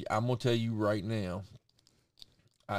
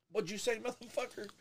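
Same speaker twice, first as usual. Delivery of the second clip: mild mock threat; 11 semitones higher, 7 dB quieter than usual. Acted angry dad reply.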